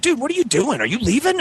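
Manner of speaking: high-pitched imitation of a worried friend; mock-flustered high voice; alarmed questioning. Imitates friend running up to car.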